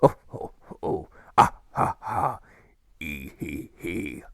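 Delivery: wheezing psychopath cackle fading to a giggle; demented laugh. A narrator's reading. Admiral walking the path, laughing like a demented psychopath. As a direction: cackle like a madman. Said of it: "laugh like a demented psychopath".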